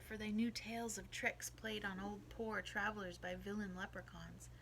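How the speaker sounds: ordinary delivery, nothing marked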